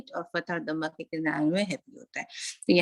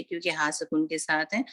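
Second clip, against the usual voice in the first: usual speaking voice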